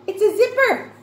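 high, loud delighted discovery; excited reveal. Pretend. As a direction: reveal it to children with big excitement. Teacher reveals a picture.

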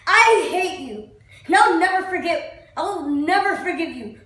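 loud, drawn-out declaration of hate, easing at the end; furious vow. Acted. Actor tells God he'll never forgive him, furious vow. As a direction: hurl the hatred up at God. Acted climax, hatred of God.

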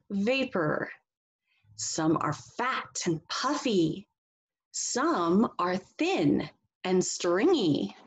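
slow, sing-song storybook reading; reading to children. Acted. Teacher reading a picture book to the class, slow and expressive. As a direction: read it slowly and expressively for young listeners.

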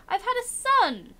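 sudden news that catches you off guard; high startled announcement of a son; surprised exclaim. Real, not acted. Surprised announcement of game event.

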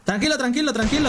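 high-pitched, urgent reassurance; high calming callout. Real, not acted. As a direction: settle the team down fast. Calming teammates in firefight. (agudo y apurado)

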